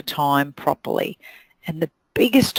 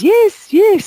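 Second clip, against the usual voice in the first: much higher, loud eager "yes, yes"; 10 dB louder than usual. Retold or imitated. Hairdresser agreeing to every booking, eagerly. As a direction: blurt it out too keen.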